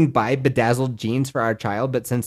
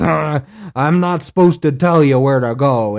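mock ranger face; 5 dB louder than usual. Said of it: "making a face at me of".

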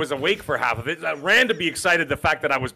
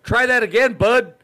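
higher-pitched mock dare; Canadian challenge; 6 semitones higher, 4 dB louder than usual. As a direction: imitate a Canadian daring someone. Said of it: "a lot of the Canadians say".

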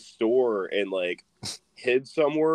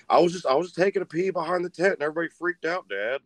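higher-pitched, hurried mock excuses; protesting son; 6 semitones higher, faster than usual. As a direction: imitate a son defensively explaining himself. Son making excuses to his dad.

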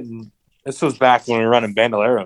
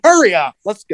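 high-pitched hurry-along; mock impatient call; retold impatient urging; 9 semitones higher, 4 dB louder than usual. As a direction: hustle someone along in a raised voice. Retold urging of the slow bellman.

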